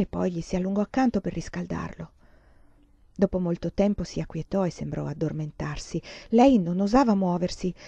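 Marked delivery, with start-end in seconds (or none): none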